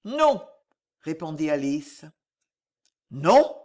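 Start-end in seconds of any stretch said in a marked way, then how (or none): 0.1-0.5 high, firm refusal; Alice's refusal. A narrator's reading. Alice refusing the monk, firm and high. 3.1-3.6 louder, indignant growl. A narrator's reading. Monk answering Alice's refusal, growling. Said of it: "gronda le moine".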